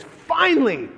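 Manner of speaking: high, drawn-out exclamation; exasperated relief. Acted. Angels watching God pour out wrath, relieved. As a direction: cry it out after waiting far too long.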